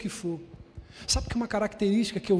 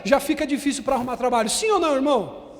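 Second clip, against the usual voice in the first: loud, high preaching build; preaching at full force; 6 semitones higher, 9 dB louder than usual. Acted. Pastor preaching loud to the church, full force. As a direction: preach it out to the congregation.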